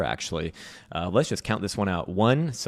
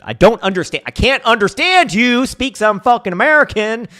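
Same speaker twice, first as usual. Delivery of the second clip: much higher, louder yell of a confused American; mock angry American; 13 semitones higher, 10 dB louder than usual. Poor American mocking the English woman's accent, hammed-up yell. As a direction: squawk it like an outraged tourist.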